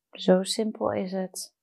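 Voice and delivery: normal talking voice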